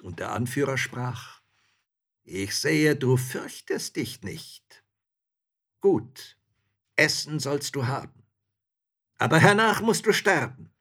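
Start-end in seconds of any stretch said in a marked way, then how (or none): 2.2-10.7 loud, raised pronouncement; threatening robber chief. A narrator's reading. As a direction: robber chief granting a last meal.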